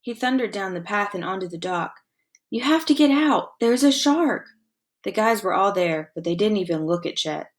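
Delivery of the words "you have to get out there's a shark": high, pleading alarm; frantic warning; urgent warning. Acted. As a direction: make them believe there's danger. Boy warns friends of shark.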